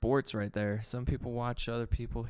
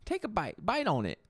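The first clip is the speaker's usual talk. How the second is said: carnival huckster; 10 semitones higher, slower than usual. Pretend. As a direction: hawk fake gold to a crowd. Playing the gold seller again.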